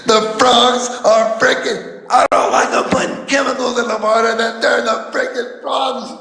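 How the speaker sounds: high, shouted conspiracy rant; Alex Jones shouting. Retold or imitated. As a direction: yell about the frogs.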